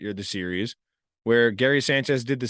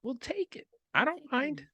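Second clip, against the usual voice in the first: voice crack; 12 semitones higher, 8 dB quieter than usual. Real, not acted. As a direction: voice cracking up high. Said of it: "Oh, voice crack".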